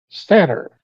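"satyr" said SAT-er; dictionary pronunciation. Shown as a demonstration. Friend checking Google Dictionary for the group, "satyr" as SAT-er. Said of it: "it says".

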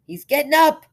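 loud high cry out; man at window shouting the news; excited call. Acted.

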